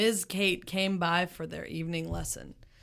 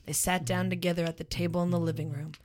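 ordinary delivery, nothing marked

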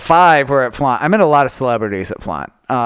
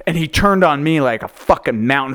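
usual speaking voice

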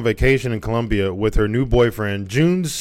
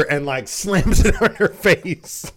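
laughing; 8 semitones higher, slower than usual. Real, not acted. Laughing while telling the dog story.

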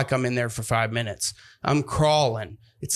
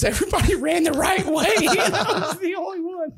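high, squeaky laughing through the words; real laughter breaking up speech; cracking up. Real, not acted. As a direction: laugh helplessly while finishing the sentence. Cracks up at his own story.

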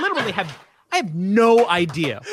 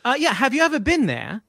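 higher, lilting British question; British director's accent; 6 semitones higher than usual. Retold or imitated. Director asking kid about England, imitated British accent. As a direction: pose it as an English director would. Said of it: "And he goes".

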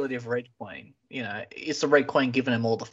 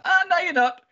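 mock taunt; 13 semitones higher, 4 dB louder than usual. Elevator yanks her back up.

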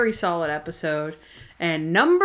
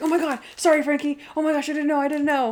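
high, pleading mock apology; hammed-up flustered apology; panicky apology; 7 semitones higher, faster than usual. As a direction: imitate someone frantically excusing themselves, pitched up. Retelling a frightened apology to someone.

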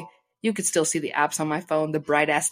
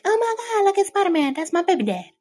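squealing high-pitched swoon, louder; gushing fangirl; 4 dB louder than usual. Retold or imitated. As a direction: swoon over Spider-Man in a very high squeal. Friend gushing over Spider-Man.

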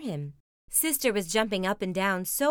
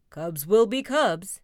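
slow, louder dad voice; shrugging Papa Bear; 6 dB louder, slower than usual. Acted. Papa excusing the cubs to Mama, slow shrugging delivery. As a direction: say it like a verbal shrug. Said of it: "Papa looked at Mama and shrugged".

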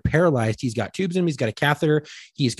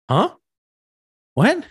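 high-pitched, incredulous questions; rising squeal of alarmed disbelief; shocked disbelief; 11 semitones higher than usual. Retold or imitated. Acting out shock at implants.

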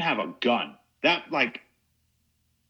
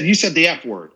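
retold accusation; 6 semitones higher, 7 dB louder than usual. Woman accusing him loudly.

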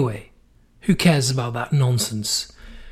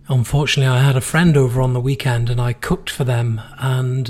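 ordinary talk, nothing marked

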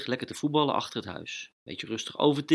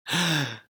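sharp gasp; 5 semitones higher than usual. A narrator's reading. Dog appears at the door.

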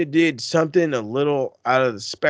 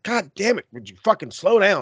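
higher-pitched mock exasperated plea; acted exasperation; 6 semitones higher than usual. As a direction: plead for him to ease up. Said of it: "And I'm just like".

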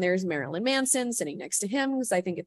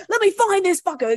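much higher, louder hammed-up outrage; mock furious friend; 12 semitones higher, 8 dB louder than usual. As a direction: play the protective buddy ready to fight.

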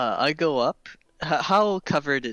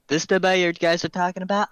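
cocky challenge; 5 semitones higher, 5 dB louder, faster than usual. Acted. Character confronts townsman cockily.

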